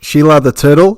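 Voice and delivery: loud Aussie "Sheila the turtle"; mock Australian accent. Host plays with Aussie words, in a mock Australian accent. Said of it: "in Australian".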